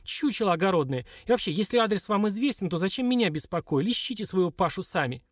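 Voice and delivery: high-pitched angry scolding; indignant outburst. A narrator's reading. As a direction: rail at them, affronted. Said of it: "закричала девушка".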